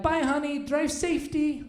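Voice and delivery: a hint of sing-out Brazilian accent; wife's foreign accent. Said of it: "a little bit of an accent".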